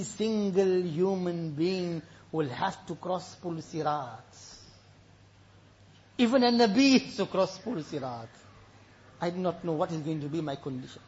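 low, hushed sermon voice; subdued, weighty delivery; solemn preaching. Real, not acted. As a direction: let the warning sink in gravely. Solemn verse and warning.

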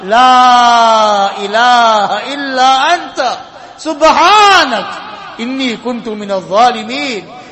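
drawn-out recitation. Real, not acted. Recites the prophet's prayer.

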